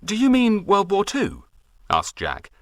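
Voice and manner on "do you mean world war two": put-on higher, curious young man; Jack's voice. Jack asking the farmer, curious and higher. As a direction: ask it keenly.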